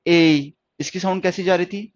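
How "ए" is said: ए held long and louder; modelling the reading. Shown as a demonstration. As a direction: read the ए long. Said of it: "क्या long जा रही है इसकी sound?".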